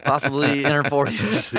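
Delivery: high, loud words through laughter; cracking up at your own joke; laughing while talking. Real, not acted.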